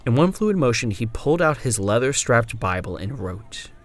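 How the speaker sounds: ordinary delivery, nothing marked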